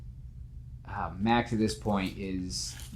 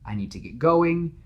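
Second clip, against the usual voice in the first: higher, a hint of a strained dad; father's voice; 9 semitones higher, 4 dB louder than usual. Father telling his son he must leave, strained. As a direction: say it like a dad covering up trouble.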